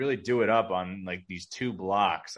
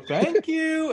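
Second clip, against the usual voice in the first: high, loud put-on kiddie thanks; silly high-pitched child voice; stupid baby voice; 6 dB louder than usual. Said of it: "in the stupid voice".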